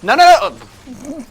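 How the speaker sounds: high, very loud panicked no; alarmed shout. Real, not acted. Sneaking player spotted by the enemy, alarmed shout. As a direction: blurt it out high and fast in alarm.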